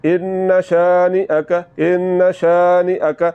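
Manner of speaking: slow ان شانئک with a brief nasal hold on the n; ان شانئک, short nasal hold on the n; slow nasal recitation. Shown as a demonstration. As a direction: hold the n of ان in the nose a moment, then release. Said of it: "آپ نے اس لفظ کو پڑھنا ہے".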